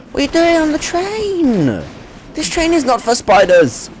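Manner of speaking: gasp, then high indignant cry; shocked outrage. Real, not acted. Gasps at spider on train.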